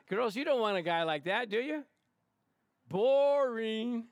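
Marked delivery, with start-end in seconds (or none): 2.9-4.1 loud, high, drawn-out singsong; stretched, held jeer; mocking call-out. Mocking the dull date.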